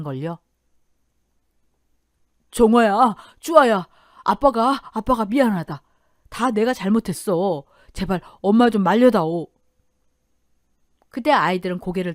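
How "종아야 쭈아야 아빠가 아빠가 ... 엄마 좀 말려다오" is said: pitiful plea, opening loud; pleading father. Acted. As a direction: beg your children to step in. Beaten father begs daughters for help.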